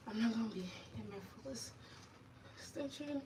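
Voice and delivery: strained, squeaky under stretch; sore muscles. Real, not acted. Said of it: "it hurts".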